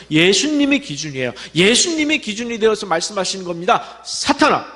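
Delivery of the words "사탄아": rebuke. Acted. Jesus rebukes Peter.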